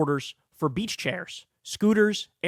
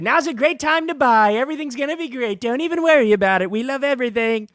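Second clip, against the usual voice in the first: high, loud mock cheerleading; mocking Trump; 6 semitones higher, 4 dB louder than usual. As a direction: gush with fake reassurance in a put-on voice. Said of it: "Trump took to Truth Social and said".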